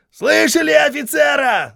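high, loud bellow; shouting drill sergeant. A narrator's reading. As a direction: bellow at the ranks. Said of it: "заорал Хейксвилл". (вопящий сержант)

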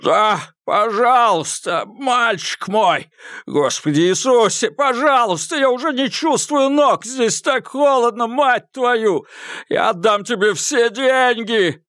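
high, frantic pleading voice; desperate frozen man. Acted. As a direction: beg for life, freezing in the river. Malcolm begs from icy water. (отчаянная мольба замерзающего)

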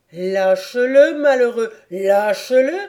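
a thundering cry of alarm; thundering shout. Acted. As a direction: roar it out to save him. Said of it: "s'écria-t-il d'une voix tonnante".

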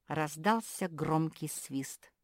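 ordinary delivery

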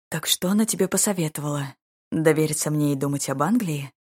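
plain reading voice

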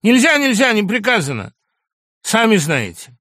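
orderly refusing to let him out; firm loud refusal. Acted. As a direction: shut him down flatly. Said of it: "оторопил Андрей Ефимович".

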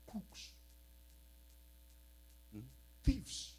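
ordinary delivery

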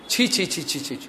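soft, fading "ছি, ছি" of disgust; disgusted tutting. Retold or imitated. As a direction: click out "ছি" in dismay, dropping to almost nothing. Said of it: "বলে". (ঘৃণায় নিচু স্বরে "ছি, ছি")

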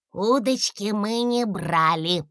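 slow, dismayed little dragon; a small dragon disappointed; upset little dragon. A narrator's reading. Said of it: "расстроился Гусли".

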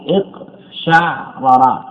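اقشعرر slowly as iq-SHA'-ra-ra; fatha shin, silent-vowel ayn. Shown as a demonstration. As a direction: break اقشعرر after the vowelless ayn, then ra-ra. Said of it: "بفتح الشين وإسكان".

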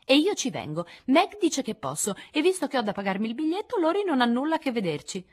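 Amy acuta e provocatoria, più alta e più forte; irritante. A narrator's reading. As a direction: scandisci con tono irritante. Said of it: "nel suo tono più irritante". (Amy sfida le sorelle, tono irritante e acuto)

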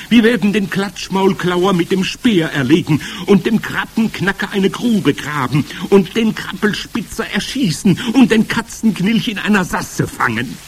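high, loud crowd boasting; rowdy bawling. A narrator's reading. Witches promising to hunt the ingredients, bawling. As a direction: bawl the plans out with relish. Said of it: "grölten sie durcheinander".

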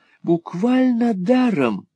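high, slow drawl falling on «даром»; assuring husband. A narrator's reading. Husband tempting wife, high slow assurance. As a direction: stretch «даром» in foppish assurance. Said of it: "отозвался Монталини".

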